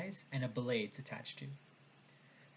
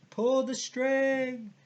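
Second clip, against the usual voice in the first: very high, drawn-out distant holler; shouting back; 11 semitones higher, 8 dB louder, much slower than usual. Acted. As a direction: call it up loud, stretching every word. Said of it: "they shouted back at me".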